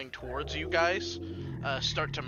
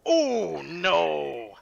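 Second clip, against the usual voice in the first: big drawn-out cry of dismay; loud groaning reaction to a roll; loud exclamation; 3 semitones higher, 8 dB louder than usual. Real, not acted. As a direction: exclaim loudly, starting high and falling. Game master reacts to dice result.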